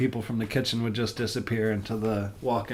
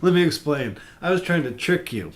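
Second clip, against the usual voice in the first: ordinary talk, nothing marked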